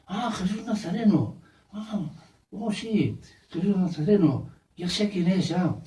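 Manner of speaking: light up at a name you know; acted eager recognition. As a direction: blind man's high-pitched dawning recognition. Blind man reacts to the news.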